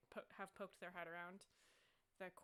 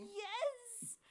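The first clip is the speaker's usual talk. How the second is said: high, loud cry of frustration; hammed-up exasperated yelp; exasperated guard; 6 dB louder than usual. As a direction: wail it, at wit's end. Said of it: "the frustrated guy is like".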